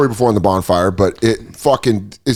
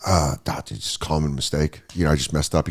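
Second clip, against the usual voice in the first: much lower, much softer sheepish waiter; meek apology; 10 semitones lower, 8 dB quieter than usual. Retold or imitated. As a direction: mumble it timidly, backing down. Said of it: "I go".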